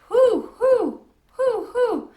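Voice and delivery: high, loud hooting owl call out of the dark; mysterious owl hoot. A narrator's reading. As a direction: hoot like an unseen owl, eerie and drawn out. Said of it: "the voice was strange and mysterious".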